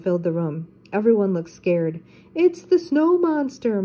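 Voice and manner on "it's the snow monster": high-pitched, slightly stretched cry of alarm; frightened character calling out, high and drawn out; yelled alarm. A narrator's reading. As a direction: yell a warning in a storybook voice. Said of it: "Mister Ruckus yelled".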